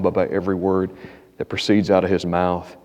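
usual speaking voice

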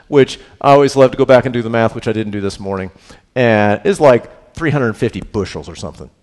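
ordinary delivery

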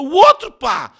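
high, loud frightened yelp; startled. A narrator's reading. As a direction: blurt it out in alarm. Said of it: "Este, assustado, respondeu".

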